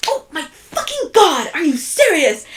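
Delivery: high, loud, drawn-out exasperated boss; imitating a shocked boss, pitched high and loud; mimicking the boss's outburst. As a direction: play up her shocked exasperation. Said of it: "she was just like".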